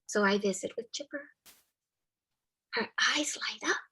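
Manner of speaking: usual speaking voice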